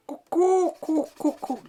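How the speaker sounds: very high hooted cuckoo; cuckoo call. Acted. Painter mimics the bird in his picture, cuckooing. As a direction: hoot it like a cuckoo.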